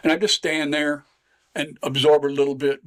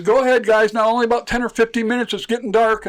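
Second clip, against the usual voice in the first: higher and louder, coaxing the fish along; talking to the fish; 7 semitones higher, 4 dB louder than usual. Retold or imitated. Fisherman tells the trout to go swim, high and coaxing. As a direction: send the trout off like kids to play.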